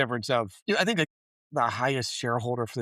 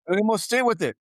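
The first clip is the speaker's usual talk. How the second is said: higher, firm and insistent; raised voice, all business; stern call to order; 9 semitones higher, 5 dB louder than usual. Retold or imitated. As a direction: snap the meeting back to work. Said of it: "He's like".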